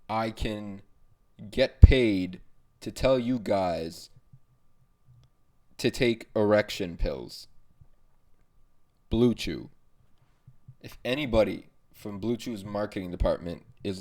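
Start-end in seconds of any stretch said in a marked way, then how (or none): 1.5-9.7 slow emphatic. Acted. Mock dream of sponsorship.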